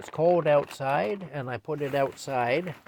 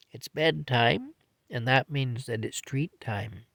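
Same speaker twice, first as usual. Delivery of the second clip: ordinary delivery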